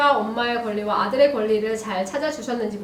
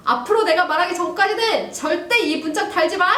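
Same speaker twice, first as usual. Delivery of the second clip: higher, emphatic warning; acted stern dad; 5 semitones higher than usual. Father forbids putting door back, acted angry final warning. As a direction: lay down the law as an angry father. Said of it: "아빠".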